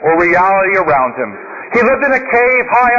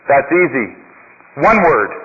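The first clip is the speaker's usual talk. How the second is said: lower, calm monk voice; a lightly voiced, lowered sage; wise old monk; 7 semitones lower than usual. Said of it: "looks at the man and says".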